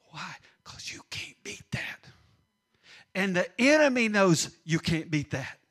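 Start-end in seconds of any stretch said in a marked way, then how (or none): 0.1-2.0 hushed, softer delivery; whispered aside. Real, not acted. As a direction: whisper it as a sure truth. Preacher confides why it works.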